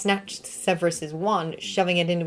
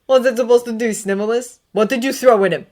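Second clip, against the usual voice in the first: loud, raised, angry questioning; angry demand; 6 semitones higher, 9 dB louder than usual. Acted. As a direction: demand it furiously. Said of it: "angry red sparks".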